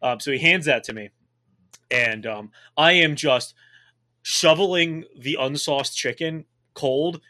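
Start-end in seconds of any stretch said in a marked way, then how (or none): none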